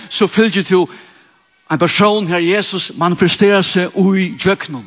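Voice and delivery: loud, driving sermon cadence, each line hammered down; preaching at full force. Acted. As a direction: preach it out to the hall, rising and urgent. (kraftig forkynnende prekenstemme)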